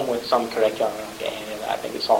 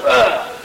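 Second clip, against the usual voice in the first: loud, high hammy dying groan; overacted death groan; 9 semitones higher, 6 dB louder than usual. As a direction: ham up a dying gasp. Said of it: "so you get a kid going".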